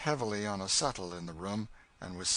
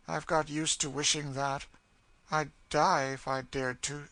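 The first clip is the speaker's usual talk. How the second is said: higher voice of settled despair; despairing woman; 7 semitones higher, 5 dB louder than usual. Acted. Cassie admitting she longs to die, fixed despair. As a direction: say it with bitter resignation.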